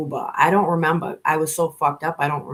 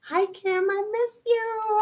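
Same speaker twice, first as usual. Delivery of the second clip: tender greeting; slower than usual. Real, not acted. Greeting old friend Kim in chat, high tender and slow. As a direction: tell her you really miss her.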